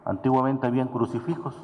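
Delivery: ordinary delivery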